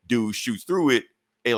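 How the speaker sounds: normal talking voice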